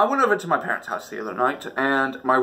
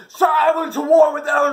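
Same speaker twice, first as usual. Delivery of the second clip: high, loud war shout; mock battle cry; 13 semitones higher, 7 dB louder than usual. Vlogger shouting about fighting the mold. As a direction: yell it like charging into battle. Comic climax of mold story.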